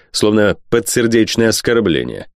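usual speaking voice

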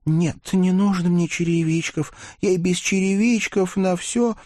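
put-on high, timid girlish voice; shy girl voice. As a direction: murmur it shyly. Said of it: "тихо пролепетала".

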